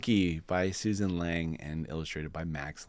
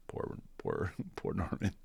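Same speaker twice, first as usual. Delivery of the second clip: pitying; 8 dB quieter than usual. Acted. Narrator pitying Norman, whispered. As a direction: hush with sympathy.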